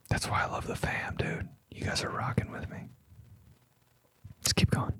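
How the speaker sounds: hushed whisper to the fans. Real, not acted. Streamer whispering thanks to his viewers, whisper. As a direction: lean in and whisper your thanks.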